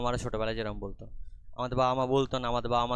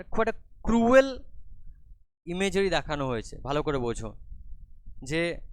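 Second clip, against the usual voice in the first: ordinary talk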